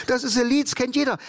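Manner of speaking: high-pitched retold insistence; insistent party guest. Club guest assures the DJ about the song, high and insistent.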